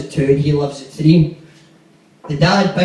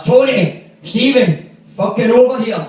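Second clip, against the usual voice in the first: angry summons; 6 semitones higher, 3 dB louder than usual. Retold or imitated. Angry neighbour calling the boys over.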